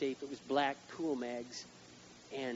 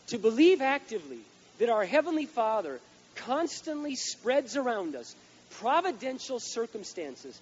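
loud, slow, higher preaching; full-force preaching; 6 semitones higher, 8 dB louder, slower than usual. Acted. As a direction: drive each word home.

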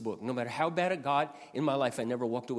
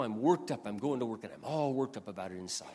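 usual speaking voice

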